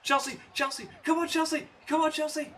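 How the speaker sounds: cheering. Real, not acted. Fan cheering on wrestler.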